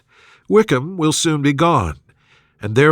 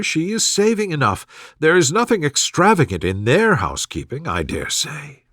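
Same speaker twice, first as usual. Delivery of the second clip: fussy mother; 3 semitones higher than usual. Acted. Mrs Bennet voiced in dialogue.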